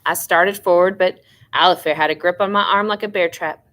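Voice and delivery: ordinary delivery, nothing marked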